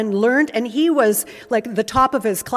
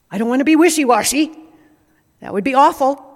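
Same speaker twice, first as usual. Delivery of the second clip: mock worried; 2 semitones higher, 5 dB louder, much faster than usual. Voicing people who fear vagueness.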